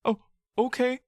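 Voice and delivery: put-on a child begging his friend for help; pleading boy. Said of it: "you say".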